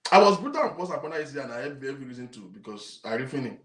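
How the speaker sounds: normal talking voice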